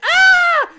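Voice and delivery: high, loud hammed-up scream; mock scream. Imitating the girl screaming at the gnome, loud and high. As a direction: scream like a bad horror actress. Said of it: "she screams and she's like".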